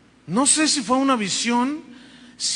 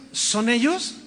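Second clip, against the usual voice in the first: ordinary talk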